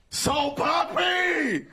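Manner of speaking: drawn-out hype call of a name; excited hype shout. Real, not acted. Host hyping up his fighter on stage, drawn-out shout. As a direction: stretch the name out, hyping the crowd.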